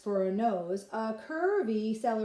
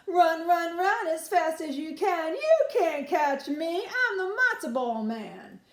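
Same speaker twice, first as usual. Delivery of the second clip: loud, higher teasing chant; taunting singsong; 6 semitones higher, 5 dB louder than usual. Acted. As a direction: tease them as you run away. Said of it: "He called back over his shoulder".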